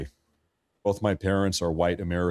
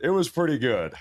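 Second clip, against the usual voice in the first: ordinary talk, nothing marked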